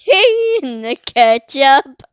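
stretched, loud and slow; slow drawn-out answer. Real, not acted. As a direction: drag the words out playfully.

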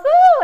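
a big, high joyful "woo"; loud high whoop. Real, not acted. Host cheering her listeners with a loud whoop. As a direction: let out a delighted cheer.

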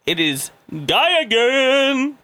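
high, long-drawn announcer build; Oprah. Acted. Host introducing Guy Again on request, drawn-out high announcement. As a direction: stretch the name into a big show introduction. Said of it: "I try to say it like Oprah".

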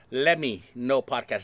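slow, careful LET ME KNOW; measured, separated words on "Let Me Know"; said slowly. Shown as a demonstration. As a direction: spell out "Let Me Know" by ear, slowly and deliberately. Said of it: "I'm gonna say this slowly".

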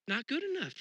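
high, pointed dismissal; dissatisfied. Retold or imitated. Looking at the world, scoffing not good enough. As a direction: scoff at how things are. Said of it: "you're like".

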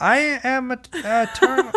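high-pitched imitation through laughter; laughing, squeaky mimicry; mock old lady. Mimics her old lady attempt.

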